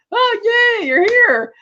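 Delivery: high-pitched silly cheer; put-on delighted voice; mock glee. Mimics Boris's clueless glee at Natalia.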